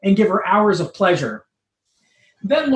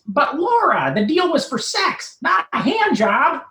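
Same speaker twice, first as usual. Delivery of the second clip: much higher, whiny nerd protest; pleading Urkel; 10 semitones higher than usual. Acted. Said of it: "Steve pleads".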